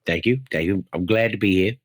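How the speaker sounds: very low-pitched guest voice; wrong character voice. Acted. Guest greets his host in the wrong character voice. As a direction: drop deep for a polite put-on character. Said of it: "I think I might have been the wrong voice".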